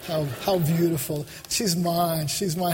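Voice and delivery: low, tender sigh over someone beloved; adoring Jesus. Acted. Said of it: "Jesus looks at you and goes".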